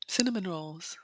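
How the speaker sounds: "Cinnamon rolls" breathed in a whisper; longing whisper. Real, not acted. Vlogger craving pastries she filmed, whispered.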